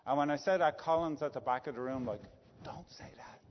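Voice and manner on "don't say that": whispered, toneless warning; mouthed warning. Retold or imitated. Colin at the back of the room, whispering a warning to [name].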